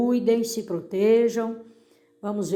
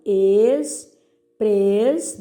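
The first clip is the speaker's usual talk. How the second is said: "Expres-" as two drawn-out syllables, ex, pres; separando sílabas; 3 dB louder than usual. Shown as a demonstration. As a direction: dictate "Expres-" piece by piece. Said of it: "separe as sílabas dessas palavras". ("Expres-" em sílabas alongadas e separadas)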